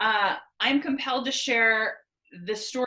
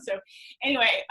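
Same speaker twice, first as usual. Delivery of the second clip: usual speaking voice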